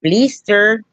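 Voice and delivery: high mock-Mexican "Blister"; hammed-up Mexican accent. Shown as a demonstration. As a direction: say "Blister" as "BLEE-ster", fake Mexican. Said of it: "Or Mexican".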